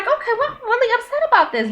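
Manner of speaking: hammed-up pouty complaint; mock upset. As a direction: whine it up high, half joking. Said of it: "And I'm like".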